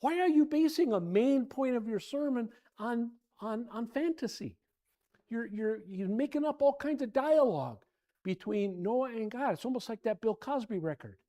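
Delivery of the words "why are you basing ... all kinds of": imagined yell. Retold or imitated. Pastor imagines phoning a preacher, exasperated yelling. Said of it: "I wanted to yell".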